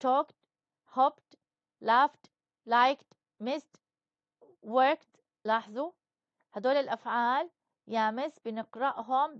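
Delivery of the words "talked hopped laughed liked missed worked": talked, hopped, laughed, liked, missed, worked, each ending on a crisp, louder t; final t sound. Shown as a demonstration. Teacher demonstrating the t ending of past verbs to the class, crisp and loud. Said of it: "مرة بلفظه تي".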